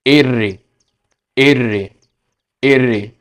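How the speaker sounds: एर्रे with a tapped r; Spanish letter name. Shown as a demonstration. Teacher to students, Spanish r. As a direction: model एर्रे slowly. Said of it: "आर को कैसे pronounce करते हैं".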